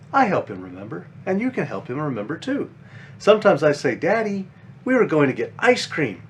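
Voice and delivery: put-on gentle, lively storybook read for kids; reading to children. A narrator's reading. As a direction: read it like a bedtime picture book. Reads children's book aloud.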